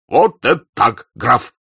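loud, slow hearty cry; approving shout. A narrator's reading. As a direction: call out firm approval. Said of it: "крикнул штаб-ротмистр".

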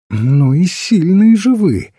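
high, loud, merry admiration; cheery priest paying a lively compliment; cheerful. A narrator's reading. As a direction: exclaim it merrily, voice lifted. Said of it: "весело сказал священник".

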